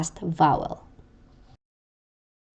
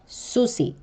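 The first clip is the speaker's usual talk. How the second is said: susi with stressed su-, abrupt catch after the i; penultimate glottal stress; 5 semitones higher, 4 dB quieter than usual. Shown as a demonstration. Teacher repeating the example, susi stressed first with glottal catch. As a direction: stress the start of susi, cut the end off. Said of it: "pronounced with a glottal catch".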